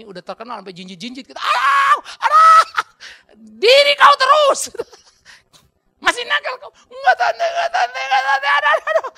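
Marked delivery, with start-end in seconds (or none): 1.4-9.1 high shouted pleading cries; mock pained yelps. Boy begging aunt while ear pulled, shouted mock pain. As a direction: yelp like a boy being pulled up.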